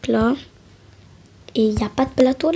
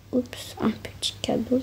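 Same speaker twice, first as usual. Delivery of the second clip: whisper; 10 dB quieter than usual. Acted. Aparté chuchoté à la boulangerie.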